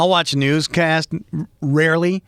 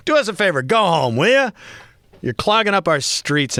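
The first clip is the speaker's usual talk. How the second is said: higher-pitched Bostonian telling-off; mock exasperation; 6 semitones higher than usual. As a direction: tell the newcomers off, half joking. Mock telling newcomers to leave.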